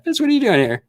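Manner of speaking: very high put-on voice; mock surprised greeting. Voicing Cara as she shows up in chat, mock surprise. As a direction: imitate a squeaky, astonished friend.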